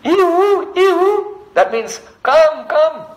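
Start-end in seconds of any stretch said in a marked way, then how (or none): 0.0-1.4 high-pitched swooping bird cry; birdcall imitation. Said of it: "some of the birds are saying". 2.2-3.0 high, drawn-out beckoning call; birds calling. Acted. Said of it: "they're calling all the other birds".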